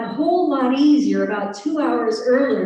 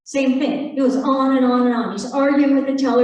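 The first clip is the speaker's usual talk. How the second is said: ordinary talk, nothing marked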